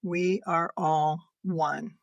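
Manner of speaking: very slow, hushed and deliberate; solemn message. Retold or imitated. Relaying her mother's last message, slow and solemn. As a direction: lay each word down slowly, like a revelation. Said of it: "her third sentence was".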